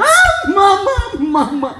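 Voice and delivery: put-on high child's wail for mama; desperate child calling mama; crying child. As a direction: wail like a kid left at school.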